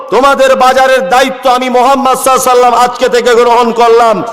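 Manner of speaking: high, ringing proclamation; full-force preaching. Acted. Head of state pledging care to widows and orphans, high impassioned oratory. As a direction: declaim it like a ruler addressing the people.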